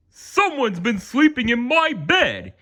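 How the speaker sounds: high put-on bear voice, a mild mock boom; great big Papa Bear voice. A narrator's reading. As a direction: play the father bear's big voice, lightly. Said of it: "he boomed in his great big voice".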